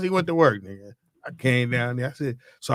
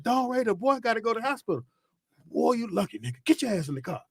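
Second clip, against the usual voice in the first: mother urging; 9 semitones higher than usual. Retold or imitated. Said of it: "And my mother said".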